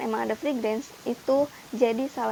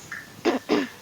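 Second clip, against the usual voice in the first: throat clearing; 3 semitones higher than usual. Real, not acted. Voice going hoarse mid-video.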